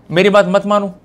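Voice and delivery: usual speaking voice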